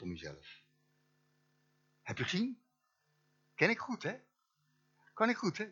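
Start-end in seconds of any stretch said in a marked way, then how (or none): 2.0-5.6 high, smug self-praise; mock boasting. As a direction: brag about it, tongue in cheek. Mock bragging about own preaching.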